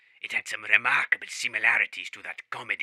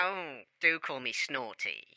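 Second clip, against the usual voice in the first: comic caller voice; 2 semitones higher, 8 dB quieter, slower than usual. Acted. Snorty caller put-on voice.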